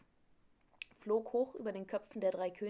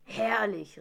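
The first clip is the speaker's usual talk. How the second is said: louder cry of delight; delighted exclamation; 6 dB louder than usual. A narrator's reading. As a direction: call it out with relish, louder. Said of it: "rief er". (begeisterter Ausruf)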